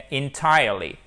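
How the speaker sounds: "Entirely" as in-TIRE-ly, weight on TIRE; stress on second syllable. Shown as a demonstration. Teacher repeating the model, stress on second syllable. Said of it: "vous avez votre accent tonique".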